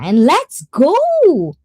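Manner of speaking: high, loud, drawn-out hype call; rally the chat with a long rising whoop; excited cheer. Real, not acted. Cheering on the stream.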